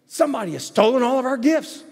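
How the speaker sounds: put-on high, loud frantic child voice; alarmed child. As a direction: blurt the bad news in a panic. Child reports stolen gifts.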